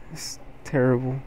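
ordinary talk

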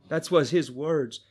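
usual speaking voice

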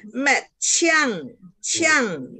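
CHIANG falling steeply each time; crisp downward tone on "Met, chiang"; sharp fourth tone. Shown as a demonstration. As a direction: drop "chiang" from a high start. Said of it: "nada empat".

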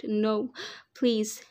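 plain reading voice

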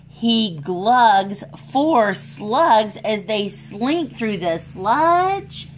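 lively storybook voice for little ones; animated read-aloud for kids; reading to children. A narrator's reading. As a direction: read it like a picture book at story time. Reads picture book aloud.

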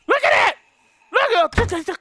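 high, loud panicked cry; alarmed shout. Real, not acted. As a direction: yell in alarm. Alarm at an open door.

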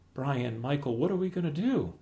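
high, anxious plea; worried mother. Acted. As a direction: plead helplessly to sons. Mom pleading for help.

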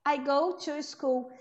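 I go to school slow, to as full TOO; strong to. Shown as a demonstration. Teacher repeating I go to school slowly. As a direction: say I go to school with a clear to. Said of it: "Esse som tem que ser forte".

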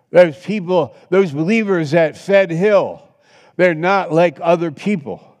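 high, loud, driving preacher's cry; full-force preaching. Acted. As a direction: hammer it home to the congregation.

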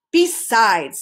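ordinary delivery

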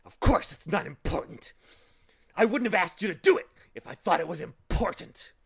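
loud, high-pitched angry retort; indignant shouting. A narrator's reading. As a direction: snap back loudly in outrage. Indignant man keeps shouting at Milo.

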